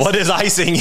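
laughing through the question. Real, not acted. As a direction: repeat it while cracking up. Laughing while repeating.

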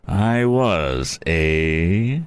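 drawn-out sneering challenge; taunting bully, rising on the last word. A narrator's reading. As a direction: sneer it back as a mocking question. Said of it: "sneered Andy".

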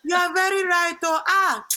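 put-on emphatic Nigerian-accented mother; imitating Nigerian mom. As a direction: agree firmly in a Nigerian mom's accent. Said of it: "And it's my mom".

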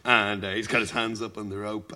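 ordinary delivery

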